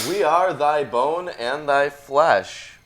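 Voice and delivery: loud, high, slow declamation; grand scripture reading. Acted. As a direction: proclaim it solemnly from the pulpit, hammed up. Reads scripture aloud.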